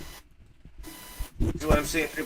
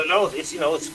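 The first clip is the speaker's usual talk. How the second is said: usual speaking voice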